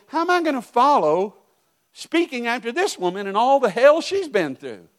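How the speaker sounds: high, loud cry of dread at having to follow her; anxious dread. Retold or imitated. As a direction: voice it like a woman panicking before her turn to speak. Pastor's kid dreads speaking next.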